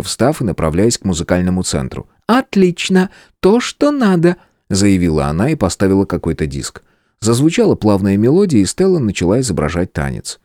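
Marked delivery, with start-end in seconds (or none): 2.3-4.4 excited. Acted. Stella finds the music.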